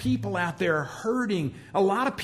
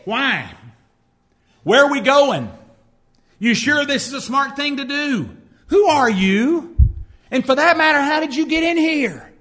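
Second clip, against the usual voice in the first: skeptical questioning; 4 semitones higher, 6 dB louder than usual. Retold or imitated. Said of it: "if Peter had been like a lot of people today".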